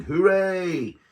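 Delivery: ordinary delivery, nothing marked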